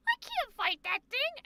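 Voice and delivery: high mock-cowardly squeak; Scanlan. Streamer imitating Scanlan encountering dragons, cowardly squeal. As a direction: whine it high like a little weenie. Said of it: "where he's like".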